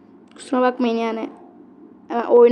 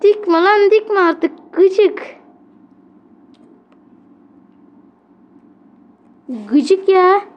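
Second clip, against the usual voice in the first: irritated snap; 6 semitones higher, much slower than usual. Real, not acted. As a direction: snap at the opponent. Annoyed at opponent's play. (sinirli çıkışma)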